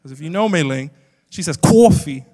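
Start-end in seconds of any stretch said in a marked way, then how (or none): 1.6-2.2 loud Brooklyn "CAW-fee"; mock Brooklyn accent, big drawn "aw"; thick Brooklyn accent. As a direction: exaggerate a New York Brooklyn "coffee". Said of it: "The thickest Brooklyn accent".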